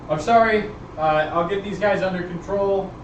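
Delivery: loud, high, eager apology to the boss; bowing servant reassuring his master; apologetic underling. Acted. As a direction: grovel a little to the gentleman. GM voices polite underling.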